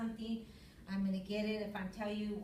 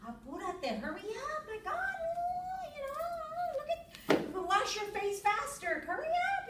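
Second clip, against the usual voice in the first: high-pitched frantic yell to hurry up; mock yelling; 3 dB louder than usual. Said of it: "and I'm still yelling at him".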